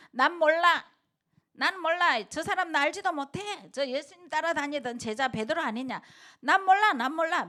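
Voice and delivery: high, loud panicked denial; acted frantic denial. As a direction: deny it all in a rush of fear. Peter denying Jesus. (베드로가 예수를 모른다고 높고 크게 부인하듯)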